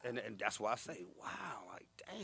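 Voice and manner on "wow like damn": very soft, near-whispered awe; hushed disbelief. Retold or imitated. Survivor reflecting on lost friends, hushed disbelief. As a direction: let it out under your breath, stunned. Recalling loss of friends, awed.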